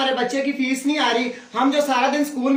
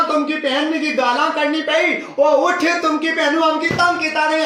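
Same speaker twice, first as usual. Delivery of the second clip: ordinary talk, nothing marked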